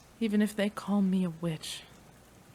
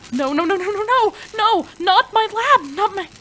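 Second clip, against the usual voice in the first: high, loud panicked cries; panicked shrieking; 13 semitones higher, 13 dB louder than usual. Acted. Scientist watching her lab go wrong, panicked high shouting. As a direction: cry out as everything goes wrong.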